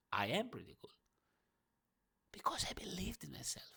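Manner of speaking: breathy self-satisfied whisper. Real, not acted. Telling the viewers how cool he is, in a whisper. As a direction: let it drop to a whisper to yourself. Boasting about himself, then whispering.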